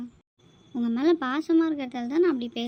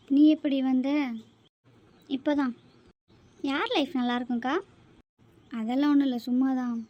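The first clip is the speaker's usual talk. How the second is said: ordinary talk, nothing marked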